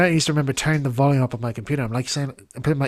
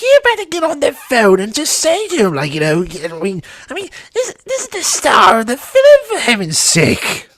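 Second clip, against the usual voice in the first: much higher, louder, slowing indignant Daisy; 11 semitones higher, 8 dB louder than usual. Retold or imitated. Daisy ordering him to phone the postman, high and indignant. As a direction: demand it like a fussy diva. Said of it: "He says, he says".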